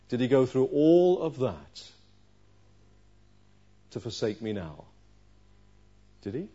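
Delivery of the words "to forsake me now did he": normal talking voice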